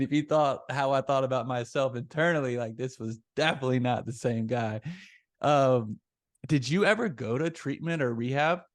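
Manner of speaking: normal talking voice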